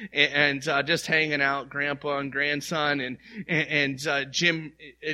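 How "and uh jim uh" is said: normal talking voice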